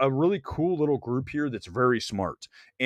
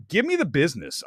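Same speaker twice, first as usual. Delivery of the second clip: ordinary delivery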